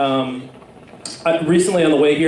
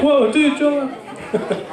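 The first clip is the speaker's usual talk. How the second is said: bro calming him; 10 semitones higher, much slower than usual. Pretend. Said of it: "It's like".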